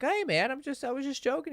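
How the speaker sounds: high-pitched mock placating voice; imitated fake buddy. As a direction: mimic a guy laughing off his jab. Imitating friend brushing it off.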